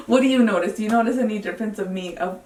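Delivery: usual speaking voice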